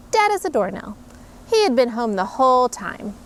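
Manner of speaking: usual speaking voice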